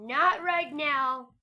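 slow, loud pleading no; drawn-out protest. Real, not acted. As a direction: stretch out not right now. Zombie attacking, pleading.

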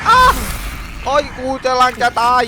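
shrieking, very high and loud; terrified shrieks in flight; panicked screaming. Real, not acted. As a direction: scream while being chased. Fleeing a chasing monster.

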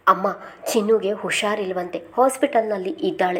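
plain reading voice

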